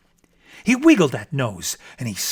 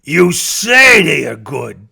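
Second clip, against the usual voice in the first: sneer; 9 dB louder than usual. Acted. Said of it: "said the bear with a sneer".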